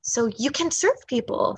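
ordinary talk, nothing marked

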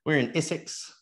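Essex said the Kiwi way, with a light New Zealand twang; a playful hint of a New Zealand accent on Essex. Teasing the host, says Essex in a mock New Zealand accent. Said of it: "you said Essex like you're a New Zealander".

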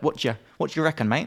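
fast, slurred "What do you reckon" run into one; "What do you reckon" squashed into a quick casual mumble; squished together. Shown as a demonstration. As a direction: rattle off "What do you reckon, mate" as one blurred word. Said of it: "can be sort of so squished together".

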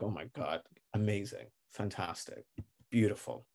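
soft, word-by-word marvelling; hushed relief. Acted. Traveller watching bags finally scanned, hushed relief. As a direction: let each word drop softly in relief.

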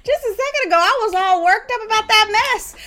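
laughing it off after calming; bubbly excited exclamation; cheerful. Shown as a demonstration. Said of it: "you're like".

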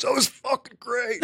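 high, breathy gushing praise; thrilled studio exclamation. Retold or imitated. A producer after a great take, gushing high praise. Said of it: "somebody goes".

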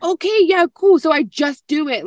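high, loud imitation of a gung-ho listener; eager agreement. Host voices a listener ready to just do it, eager agreement.

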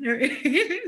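short rising laugh. Real, not acted. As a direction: chuckle at yourself. Laughing at own attempt.

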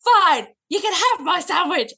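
high, loud exasperated snap; angry snap. Retold or imitated. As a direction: bark it out, fed up. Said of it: "I went".

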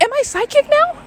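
very high, squeaky incredulous question; mock freaked-out squeak. Storyteller repeating her stunned reaction, very high squeaky disbelief. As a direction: pitch it right up in hammed-up alarm. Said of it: "I start freaking the fuck out".

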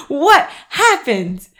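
high, drawn-out baffled question; slow, high mock dismay; mock bewilderment. Acts out baffled exerciser.

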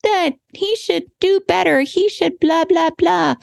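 high, mock-indignant griping voice; gossipy girlfriend complaint. Pretend venting to a girlfriend about her husband, high mock gripe. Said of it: "get with a girlfriend and be like".

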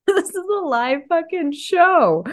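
high, slow laughing delivery. Real, not acted. Host laughs and announces the show is live. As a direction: say it through a grin. Laughing after mock intro.

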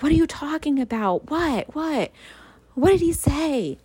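high-pitched, frantic imitation of a panicking mother; panicked mom. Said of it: "she was panicking".

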